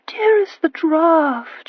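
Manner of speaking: slow, high, loud moan; mother moaning about the draught, slowly. A narrator's reading. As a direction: drag the words out like a swooning lady. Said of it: "she moaned".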